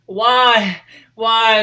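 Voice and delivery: high, loud, drawn-out cries; hammed-up despair, crying out twice; retold anguished wail. Retelling him crying blood out his eye, high mock wail.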